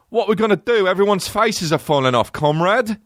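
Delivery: high-pitched hammed-up British voice; mock British accent. British actor playing a Russian comrade, mock British accent. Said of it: "literally British".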